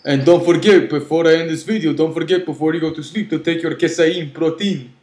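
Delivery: high, loud, fast promo voice; announcer voice. Pretend. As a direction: hype up the sign-off like an ad. Mock announcer sign-off.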